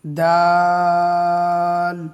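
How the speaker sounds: long chanted দাল; drawn-out dal. Shown as a demonstration. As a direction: stretch দাল four alif lengths. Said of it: "চার আলিফ টান হবে".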